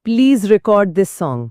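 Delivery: "record" said slowly, stress on CORD; slow verb example. Shown as a demonstration. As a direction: spell out the verb use very slowly. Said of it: "मतलब कुछ record करना". (धीरे-धीरे, "record" के दूसरे हिस्से पर ज़ोर)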